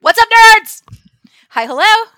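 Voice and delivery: big shouted hello to the listeners; loud greeting. Real, not acted. Host greets listeners with a loud shout. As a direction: call it out like a show opener.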